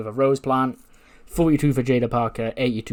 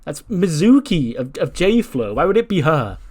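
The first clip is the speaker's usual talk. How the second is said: mildly exasperated, higher-pitched protest; incredulous; 5 semitones higher, 4 dB louder than usual. Retold or imitated. Lyra questioning Becky's choice of suspect, mildly incredulous. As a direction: swoop up in disbelief.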